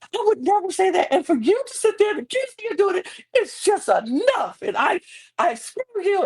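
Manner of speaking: high-pitched, overwrought indignation; squealed mock outrage, playing the wronged one; mock defensive outburst. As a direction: act out an over-dramatic, offended denial. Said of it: "you can't get defensive".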